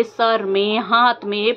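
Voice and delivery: ordinary delivery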